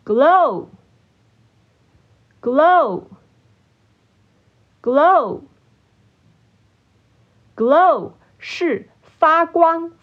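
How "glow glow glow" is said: glow said slowly, long pause after each; repeat-after-me drill. Shown as a demonstration. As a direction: model the word glow for learners to echo. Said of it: "请大家跟我一起念三次". (跟读示范，一词一顿)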